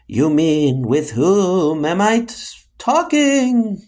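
eerie, stretched-out words; spooky voice. Acted. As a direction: correct grammar like a phantom. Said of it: "not in that spooky voice".

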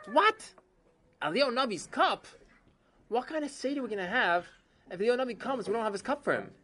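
shocked husband. Acted. Chaim is horrified his wife would sell the cup, high alarmed voice. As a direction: protest in shock.

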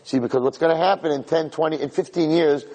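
ordinary delivery

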